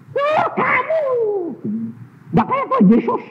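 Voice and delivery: very high shouting complaint; shrill retort. Retold or imitated. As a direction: shout back at the pastor. Woman shouting back. (teriakan ibu yang marah)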